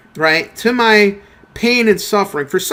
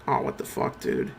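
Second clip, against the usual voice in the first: whispered, much softer curse under the breath; startled whisper; 13 dB quieter than usual. Real, not acted. A gamer caught off guard mid-match, whispered curse. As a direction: hiss it to yourself in shock. Sudden game moment.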